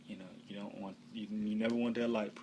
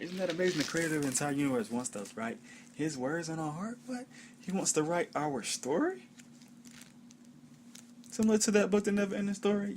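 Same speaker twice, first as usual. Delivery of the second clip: higher-pitched excited wonder; amazed; 9 semitones higher, 4 dB louder than usual. Real, not acted. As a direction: marvel aloud, voice lifting on the questions. Marvelling at creator writing our story.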